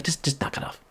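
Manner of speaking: whispered, secretive order; soft hushed voice of officials; hushed quote. Retold or imitated. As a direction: hiss it like a secret warning. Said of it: "They would be like".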